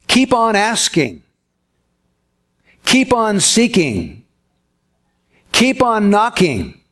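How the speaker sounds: slow, weighty "keep on" each time, leaning on "keep"; stress on keep. Shown as a demonstration. Preacher unpacking the Greek tense, stressing "keep". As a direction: pause and land hard on "keep" before each verb. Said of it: "what Jesus is actually saying is".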